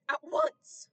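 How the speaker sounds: huge loud bark of a command; bellowed. A narrator's reading. Oakland bellows at the frozen crowd to move. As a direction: roar it so everyone moves. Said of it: "stamped his foot and bellowed".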